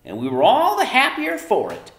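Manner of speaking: high, loud nagging mother; Homily nagging. A narrator's reading. Mother lecturing daughter about chores, high loud nag. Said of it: "Homily went on".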